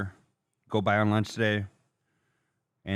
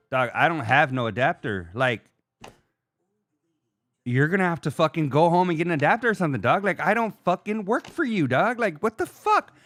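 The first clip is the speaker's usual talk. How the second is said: frustrated rant; 6 semitones higher than usual. Retold or imitated. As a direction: vent your frustration at the worker. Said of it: "I'm fucking frustrated".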